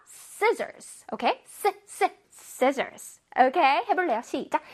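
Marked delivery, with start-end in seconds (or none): 0.1-1.0 "Scissors" with SCI high and intense; forceful SCI. Shown as a demonstration. Teacher drilling pupils on SCI, intense stress. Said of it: "S C I 부분을 강렬하게 발음해주자". 1.4-2.2 "쓰" as short, tense hissed bursts; forceful s sound. Shown as a demonstration. Teacher isolating SCI for pupils, forceful hiss. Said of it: "강렬하게 발음해주자".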